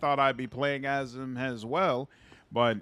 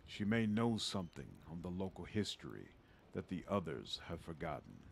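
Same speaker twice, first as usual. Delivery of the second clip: put-on old father voice; 6 semitones lower, 9 dB quieter than usual. Father figure in game dialogue.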